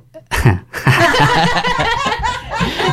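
squealing embarrassed laugh; high-pitched giggle of panic; nervous high laugh. Real, not acted. Put on the spot for accent.

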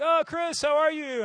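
high, loud imitation of a delighted host; hammed-up high-pitched welcome; exuberant greeting. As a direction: greet an old friend with big, loud delight. Acting out friend's effusive greeting.

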